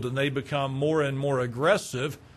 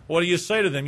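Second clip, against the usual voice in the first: ordinary talk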